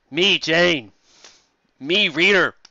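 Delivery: mock the choppy prose; a touch of caveman. Caveman parody of fragments.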